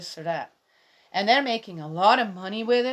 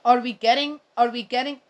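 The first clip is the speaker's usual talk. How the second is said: ordinary talk, nothing marked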